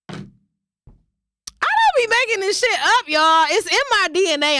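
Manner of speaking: sassy insistence. Real, not acted. Insisting to viewers, exaggerated.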